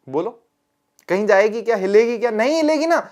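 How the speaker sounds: ordinary delivery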